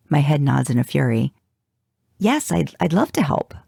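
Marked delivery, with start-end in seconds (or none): 2.2-3.5 eager. A narrator's reading. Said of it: "my eagerness".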